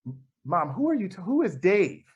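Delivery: acted high-pitched bewilderment; retold baffled question with swooping pitch. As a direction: ask it high, utterly puzzled. Retelling baffled question to her mother-in-law.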